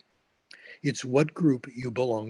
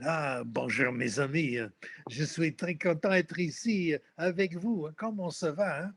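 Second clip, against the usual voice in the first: hammed-up French accent, speaking French; exaggerated French accent; 3 semitones higher than usual. Student greeting French examiners in a mock exaggerated French accent. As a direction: lay the French accent on thick. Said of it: "an exaggerated French accent".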